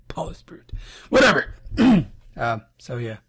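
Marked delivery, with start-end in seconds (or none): 0.1-0.7 tallest brute whispered, unvoiced; whispered repeat. Shown as a demonstration. Reader mulling the poem's line, whispering.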